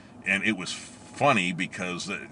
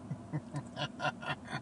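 breathy laugh; 7 semitones higher, 14 dB quieter than usual. Real, not acted. Laugh at own threat.

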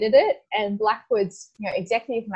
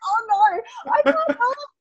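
mock embarrassed dismay; 14 semitones higher, 3 dB louder than usual. Embarrassed in red at awards.

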